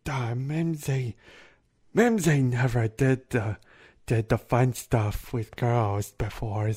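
slow, dim-witted giant voice; simple giant. Acted. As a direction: plod through it like a bashful oaf. Plays timid giant Mimsy.

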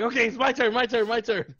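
high, eager repeated demand; excited kid-like clamoring; acted eagerness. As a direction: reenact jumping in for a turn. Acts out eager demand for turn.

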